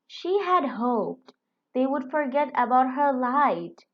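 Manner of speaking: ordinary delivery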